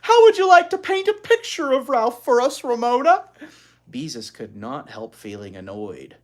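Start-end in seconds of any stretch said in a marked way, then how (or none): 0.0-3.2 high, loud laughing teacher voice; teacher laughs delightedly at Ramona, high and loud. Acted. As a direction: gush through a laugh. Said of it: "Miss Robbins laughed".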